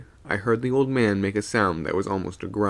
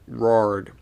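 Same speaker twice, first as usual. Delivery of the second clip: ordinary delivery, nothing marked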